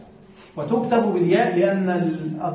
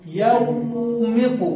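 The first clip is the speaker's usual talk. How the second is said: stretched very slowly, half sung; slow sung-out يومق; drawn-out demo; 2 semitones higher, much slower than usual. Shown as a demonstration. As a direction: draw out يومق slowly like a chant. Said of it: "المضارع".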